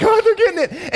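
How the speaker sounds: high-pitched imitation of thrilled saints; squeal-like glee of watchers, imitated; excited onlookers. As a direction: pitch leaps up in mock heavenly delight. Saints imagined cheering from heaven.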